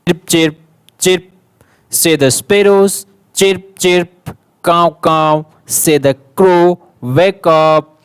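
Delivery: loud, lively classroom read-aloud; teacher reading a picture story aloud to young pupils; reading to children. Acted. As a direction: project the story to the whole class.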